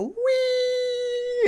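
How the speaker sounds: long, high squeal of a thrown bunny; mock squeal of flying through the air; imitated bunny wee. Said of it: "and it makes, like, a".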